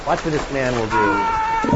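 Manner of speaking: slow, loud mock announcer; hammed-up commercial read; slow loud ad narrator. TV ad playing in sermon.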